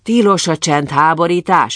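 mildly stern official warning; calling to order. A narrator's reading. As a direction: rebuke a noisy reveller. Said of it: "intette rendre az őr".

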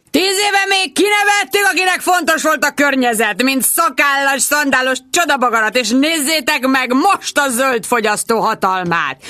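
high, declaiming rant; high ranting, slowing at the end; ranting yell. Acted. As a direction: preach it like a drunk orator. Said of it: "ordította".

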